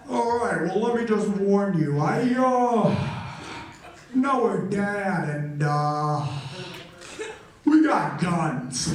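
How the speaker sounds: slow, winded warning, imitated; mock breathless coach making a threat; out-of-breath gym teacher. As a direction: drag the words out on spent breath. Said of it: "sounded like he was out of breath".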